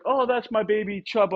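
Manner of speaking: high, doting coo about her dog; doting pet owner. Retold or imitated. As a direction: gush over the dog like a proud owner. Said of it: "she's like".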